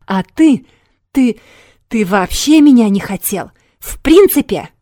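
high anguished yell; shouting. Acted. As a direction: shout it out, voice rising. Quarrel, she breaks into yelling.

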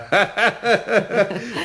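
a short happy chuckle; a craftsman chuckling over his new puppet idea. Acted. Eder delighted, chuckling to himself.

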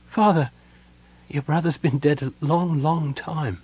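high, halting, grief-struck daughter; broken. A narrator's reading. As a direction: break it gently, voice faltering. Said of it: "but said brokenly".

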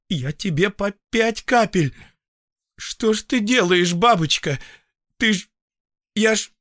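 high, agitated, breaking into fragments; aghast reproach. A narrator's reading. Doctor aghast at patient who overdosed, agitated. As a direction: scold in rising alarm until words run out.

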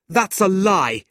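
loud, high furious shout; angry shout. A narrator's reading. Nicholas bursts in shouting at his lying uncle. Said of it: "an angry voice shouted".

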